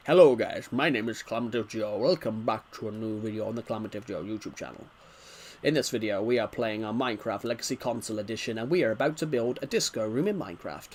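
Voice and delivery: low put-on video intro voice; changed voice demo. Shown as a demonstration. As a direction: drop your voice for a channel intro. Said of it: "I can change my voice at will. Like this".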